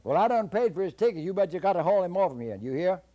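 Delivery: high, loud, fast demand; high loud insistence; insisting. Acted. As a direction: lay down the law. Jack insists to conductor.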